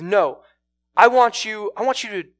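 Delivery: bully them into obeying, each demand punched out; acted angry order. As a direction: play a man losing his temper. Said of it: "he gets angry with her".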